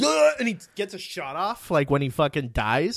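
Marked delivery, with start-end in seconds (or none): 0.0-0.3 mock death groan. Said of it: "He's like".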